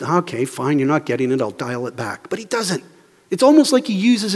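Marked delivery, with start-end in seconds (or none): 0.0-2.3 low, softer concession; resigned backing down. Pretend. An imagined Jesus conceding to confused followers, resigned. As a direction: give in and let it drop, quietly.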